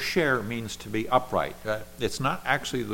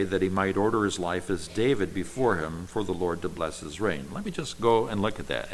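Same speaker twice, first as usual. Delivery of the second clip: ordinary delivery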